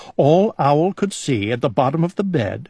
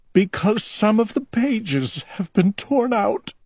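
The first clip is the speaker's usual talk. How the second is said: crying; 9 semitones higher than usual. A narrator's reading. Owl crying.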